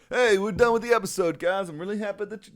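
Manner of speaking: lightly voiced, high, cheery host; a light hint of his upbeat friend; impression of co-host. Mocking his co-host's voice, mildly high and cheery. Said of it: "this is my impression of your voice".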